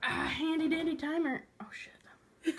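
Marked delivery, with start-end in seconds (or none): 1.6-2.5 whispering. Real, not acted. Whispering while setting timer.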